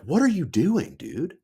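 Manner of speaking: high-pitched exasperated demand, imitating Dad; mock exasperation, pitch jumping up. Dad confronting his apprentice, exasperated.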